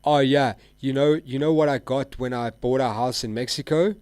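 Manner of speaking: loud smug bragging; mock bragger. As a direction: ham up a smug show-off. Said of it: "the one guy goes".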